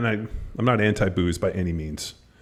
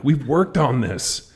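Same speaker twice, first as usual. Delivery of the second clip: slow, higher-pitched retold complaint; frustrated parent stretching each word; exasperated dad; 6 semitones higher, 4 dB louder, much slower than usual. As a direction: drag it out in mock exasperation. Frustrated dad voiced in story.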